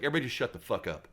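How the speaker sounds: retold loud, fast order; Bodette. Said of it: "Bodette's like".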